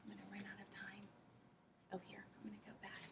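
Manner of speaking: whispered to herself; quick whispered aside about running out of time. Real, not acted. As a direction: whisper it under your breath. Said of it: "aside to herself".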